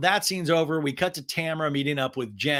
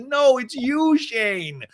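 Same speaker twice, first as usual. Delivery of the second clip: high, loud mock accusation; retold tearful protest; 10 semitones higher, 5 dB louder, much slower than usual. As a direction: imitate an upset wife blaming her husband. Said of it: "then Emily starts crying".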